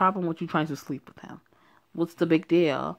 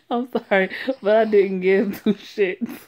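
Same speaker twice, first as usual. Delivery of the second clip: loud, amused, still cracking up; laughing through words; 5 semitones higher, 5 dB louder than usual. Real, not acted. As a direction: push the lines out between laughs. Still giggling over the Speedo.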